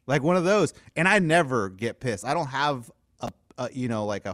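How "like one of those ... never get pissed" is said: ordinary talk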